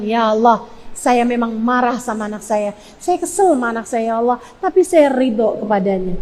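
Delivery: low, subdued confession; prayer while angry. Shown as a demonstration. Angry mother telling God she still accepts, low quiet prayer. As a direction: admit it softly to God. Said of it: "di saat marah kamu bilang".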